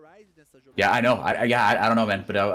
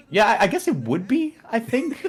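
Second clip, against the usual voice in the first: usual speaking voice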